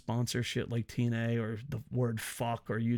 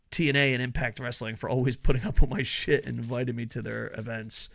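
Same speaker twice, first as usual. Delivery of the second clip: normal talking voice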